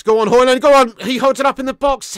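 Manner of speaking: loud, high cheering-on; excited urging. Real, not acted. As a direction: shout the striker forward. Urging striker on during attack.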